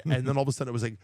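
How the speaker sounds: ordinary talk, nothing marked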